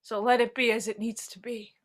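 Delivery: a breathy, low whisper; whispered resignation. Acted. Character accepting fate, whispered.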